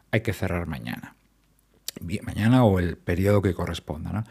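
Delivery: normal talking voice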